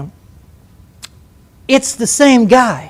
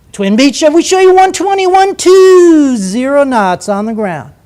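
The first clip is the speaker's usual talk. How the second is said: high, loud sing-song radio reply, stretched out; self-important controller voice; 6 semitones higher, 6 dB louder, slower than usual. Retold or imitated. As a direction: address him as if he were Air Force One. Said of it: "talk to him like he's Air Force One".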